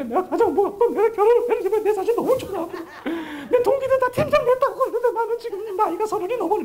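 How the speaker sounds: a wife finally spilling her frustration; high, wavering complaint of a stay-at-home wife; wife's lament. Acted. Wife pouring out her feelings.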